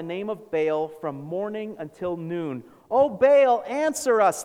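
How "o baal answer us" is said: loud, high cry to a god; raised, pleading shout; shouted plea. A narrator's reading. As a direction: call out as if shouting to heaven. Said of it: "they shouted".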